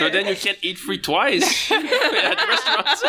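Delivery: high voice breaking into laughs; laughing joke. Real, not acted. Friend teasing about free birthday meals, laughing. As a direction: tease through giggles.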